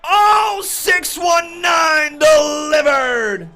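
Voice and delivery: high, loud, stretched-out shouts on the big spots; milk the finish, slow and screaming; live play-by-play. Real, not acted.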